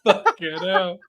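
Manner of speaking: laughing through the words; real giggles breaking up the swearing. Real, not acted. Cracking up at his mate's Kermit joke, laughing.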